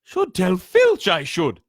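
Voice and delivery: high, slow, falsely pious poltergeist; mock-holy high voice, loud and slow; saintly voice. A narrator's reading. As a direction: sound innocent while plotting mischief. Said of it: "in a saintly voice".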